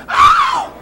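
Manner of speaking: high shrieking soul yelp; hammed-up falsetto scream. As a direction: let out a piercing singer's yelp. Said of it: "[name] would pull it".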